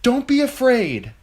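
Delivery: high, loud calming call; loudly. A narrator's reading. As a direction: call out over the crowd to calm them. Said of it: "she told them loudly".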